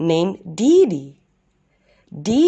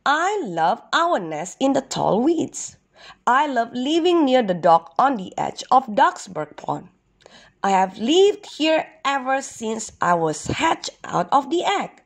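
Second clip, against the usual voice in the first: higher voice for a wistful duck; lightly voiced young duck; 7 semitones higher than usual. Duck tells turtle about his home, lightly voiced. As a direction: pitch it up a little, fondly recalling home.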